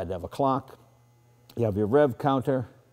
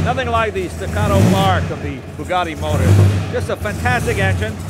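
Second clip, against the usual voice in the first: talking loudly and higher over a roaring engine; raised voice over engine; 11 semitones higher, 9 dB louder than usual. Real, not acted. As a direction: shout over the motor while driving. Talking while driving over engine noise.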